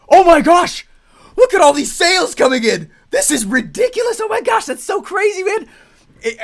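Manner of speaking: over-the-top excitement. Retold or imitated. Client calling on launch day about sales, giddy squeal. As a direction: gush over the sales, then settle into eager chatter. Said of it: "they'd be like".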